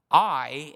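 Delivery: a loud, weighty "I"; emphatic I. Retold or imitated. God declaring himself to David, emphatic "I". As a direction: land hard on "I". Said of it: "it's said in an empha".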